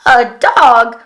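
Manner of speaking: loud, puzzled robot query; surprised robot. Acted. Robot hearing the dog idea, surprised.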